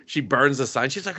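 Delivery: usual speaking voice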